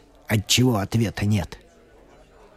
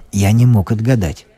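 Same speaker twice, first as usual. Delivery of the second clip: usual speaking voice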